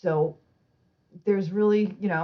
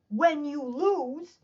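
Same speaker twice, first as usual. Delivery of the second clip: higher, very slow and drawn out; exasperated correction; 7 semitones higher, much slower than usual. Retold or imitated. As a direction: imitate an instructor spelling it out slowly. Retelling instructor's exasperated correction.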